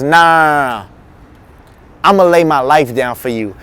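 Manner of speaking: low, easy brush-off, then a vow; confident refusal. Acted. Peter's confident vow, acted.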